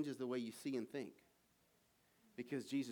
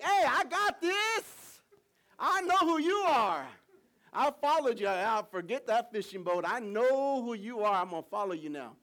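eager disciple; 9 semitones higher, 12 dB louder than usual. Retold or imitated. Simon telling Jesus he knows, high eager outburst. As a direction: burst out with it, so sure. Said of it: "Simon was like".

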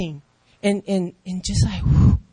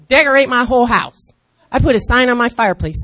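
normal talking voice

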